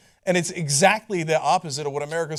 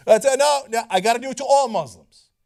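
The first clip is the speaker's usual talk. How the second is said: usual speaking voice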